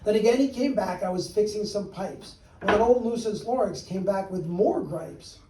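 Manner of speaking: ordinary delivery, nothing marked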